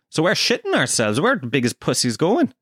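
ordinary talk, nothing marked

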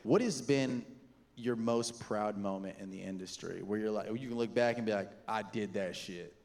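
interviewer voice. Real, not acted. Host turns to formal interview.

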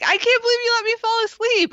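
high, fast, frantic mock panic; panicked Jen. Jen waking up next to Pacey, in mock high panic. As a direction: blurt it out in a hammed-up freak-out. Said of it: "And she just, like".

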